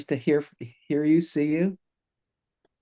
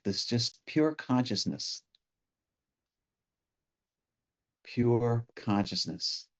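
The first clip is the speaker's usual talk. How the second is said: guided meditation; 2 semitones lower, 3 dB quieter than usual. Real, not acted. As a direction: ease listeners gently into stillness, leaving space between phrases.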